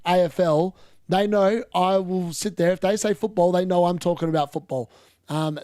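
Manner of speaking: ordinary talk, nothing marked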